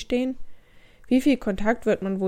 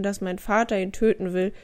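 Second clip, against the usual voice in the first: ordinary talk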